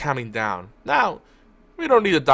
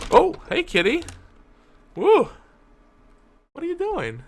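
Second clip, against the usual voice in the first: much higher, louder sing-song pet voice; 5 dB louder than usual. Real, not acted. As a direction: coo at the cat as she jumps up. Greeting her cat on the desk.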